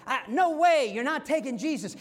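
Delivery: loud, high, outraged protest; indignant Peter. Retold or imitated. Peter shouting at the soldiers, loud and indignant. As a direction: burst out defending Jesus. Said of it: "He's like".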